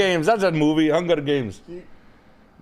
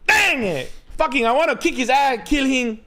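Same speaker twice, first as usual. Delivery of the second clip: mock indignant rant; 10 semitones higher than usual. Mock indignant tough-guy persona.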